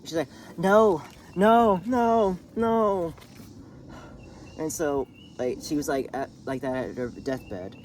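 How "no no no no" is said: anguished cry. Retold or imitated. Sister arriving too late.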